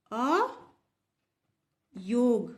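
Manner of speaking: अयोग stretched very slowly, syllable by syllable; अयोग slowly drawn out as a teacher's demo. Shown as a demonstration. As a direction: spell out अयोग slowly for the class. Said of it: "वाह मतलब होता है वहन करना".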